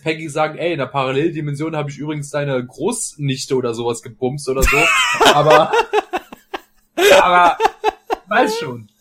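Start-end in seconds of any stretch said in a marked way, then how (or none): 4.8-5.3 burst of high laughter. Real, not acted. Joke about parallel dimension. 5.3-8.9 laughing. Real, not acted. Laughing after own joke.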